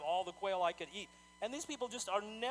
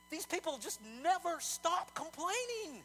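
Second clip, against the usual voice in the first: exasperated; 10 semitones higher, slower than usual. Real, not acted. Exasperated summing up of complaints.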